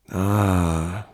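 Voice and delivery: long, lingering "Ah"; drawn out. A narrator's reading. Father-in-law realises what he had told Brunetti, drawn out. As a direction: stretch the syllable out slowly. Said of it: "sagte der Conte gedehnt".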